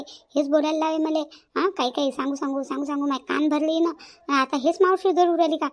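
ordinary delivery

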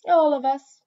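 high, loud, firm cat voice. A narrator's reading. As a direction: state it firmly, allowing no doubt.

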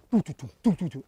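quick doo-doo gallop noises; imitated hoofbeats. Guide asking listeners to imagine cavalry, imitated hoofbeats. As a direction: drum out horses' hooves with your mouth.

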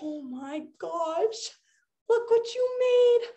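slow, drawn-out amazement after a gasp; awestruck wonder. Pretend. Cat seeing what the magic made, awestruck wonder. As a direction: marvel slowly at what has appeared.

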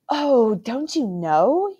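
put-on a child amazed anyone wouldn't know; babbling on. A narrator's reading. Caspian babbling to his uncle, innocently surprised. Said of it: "But he babbled on".

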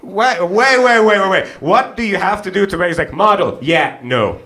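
normal talking voice

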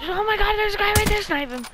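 high, fast excited yell; urgent gamer shout. Real, not acted. Spots an enemy mid-game.

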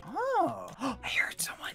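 hushed, startled whisper; worried whisper. Real, not acted. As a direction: whisper as if someone might hear. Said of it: "Why are we whispering?".

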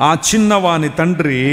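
slow, firm preaching repeat; preacher repeating verse. Acted. Preacher slowly echoing the verse to his congregation. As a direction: echo the verse slowly and firmly.

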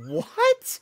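high, sharp disbelieving what; bewildered, leap way up in pitch; surprised what. Retold or imitated. Hearing about a doppelganger.

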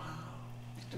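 barely voiced, deflated wow; stunned whisper. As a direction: whisper it as if let down. Retold stunned reaction to faint praise.

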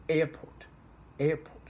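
AIRport, AIR hit high and loud; stress on first part. Shown as a demonstration. Teacher demonstrating compound nouns, strong stress on AIR in "airport". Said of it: "stress point should be is the first part".

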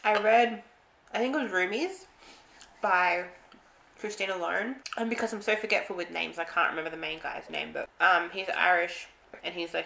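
speaking between bites; talking while chewing. Real, not acted. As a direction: mouth partly full of pancake. Seen 1: Bite taken at -2; pursed, working mouth and finger-licking while speaking.

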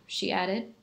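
ordinary delivery, nothing marked

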